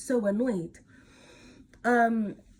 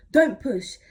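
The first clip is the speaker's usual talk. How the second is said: firm command; 4 semitones higher, 9 dB louder than usual. Retold or imitated. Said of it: "So they're like".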